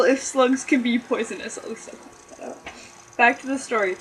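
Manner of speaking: ordinary delivery, nothing marked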